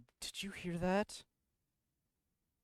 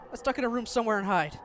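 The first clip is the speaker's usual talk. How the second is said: hurried excitement; 8 semitones higher, 10 dB louder, faster than usual. Acted. Reads in character while fleeing.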